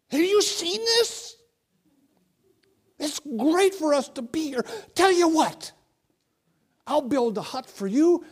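high, eager, babbling Peter; breathless eager disciple, words tumbling out; excited Peter. Acted. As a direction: play Peter gushing with excitement. Preacher voices excited Peter.

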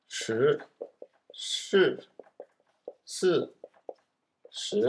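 slow, careful syllables す and し from the tongue twister; tongue twister demo. Shown as a demonstration. Teacher slowly demonstrates the し/す tongue twister to learners. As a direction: sound out each し and す deliberately. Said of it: "僕はフェードアウトします".

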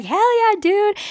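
high, loud hype cheer back. Retold or imitated. Cheering back at her friend, high and loud. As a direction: shout it back, hyped.

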